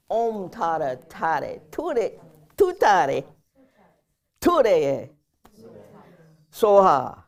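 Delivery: loud, drawn-out phrases for repeating; mantra call-out. Shown as a demonstration. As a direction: lead a group through the mantra, loudly. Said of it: "Can you say that?".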